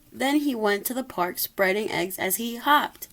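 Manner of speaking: ordinary delivery, nothing marked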